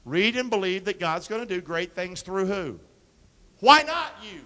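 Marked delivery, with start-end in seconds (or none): 3.6-4.4 loud, high challenge; rallying cry. Acted. Pastor challenging the congregation, loud and high. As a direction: fire them up.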